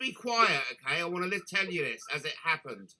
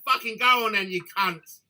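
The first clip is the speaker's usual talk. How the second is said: louder and higher, hammed-up telling-off; a retold angry shove-off, louder and higher; mock angry outburst; 5 semitones higher, 8 dB louder than usual. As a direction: relive snapping at the creep, playing it big. Retelling telling Elton off.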